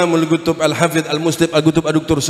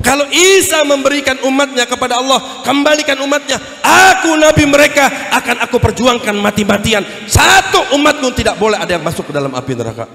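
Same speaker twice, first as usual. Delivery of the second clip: insistent declaration, punching the key words loudly; impassioned vow; 8 semitones higher, 3 dB louder than usual. Acted. Prophet vowing to fight for his followers, impassioned. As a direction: vow it with fierce conviction.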